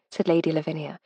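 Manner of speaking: ordinary delivery, nothing marked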